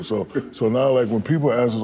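normal talking voice

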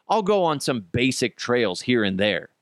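usual speaking voice

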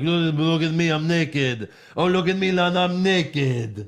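lightly mocking, drawn-out boast; showing off. Host mimics a wrestler being naked on reality TV, showing off. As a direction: imitate a show-off, stretching the words.

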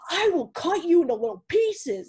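high, loud, put-on menacing voice; 1 mock psycho threat. To her ex, mock crazed threat in a high loud voice. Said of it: "I was like".

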